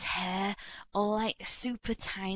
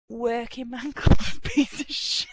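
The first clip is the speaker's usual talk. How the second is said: laughing insult; 4 semitones higher, 7 dB louder than usual. Acted. Repeats insult, louder, laughing.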